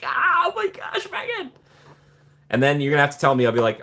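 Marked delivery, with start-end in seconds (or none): 0.0-1.5 very high, drawn-out mock squeal; hammed-up falsetto freak-out; starstruck freak-out. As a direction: squeal like an overwhelmed fan. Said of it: "I'll freak out".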